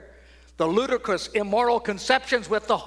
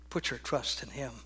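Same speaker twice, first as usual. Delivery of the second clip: quiet; 7 semitones lower, 10 dB quieter than usual. Real, not acted. Quiet appeal in sermon.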